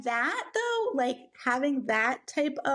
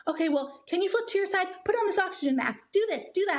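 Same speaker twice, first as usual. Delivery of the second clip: higher, pressing instructions; urgent nurse orders; 6 semitones higher, faster than usual. Retold or imitated. Nurses prepping a worried mother in labour, urgent higher-pitched orders.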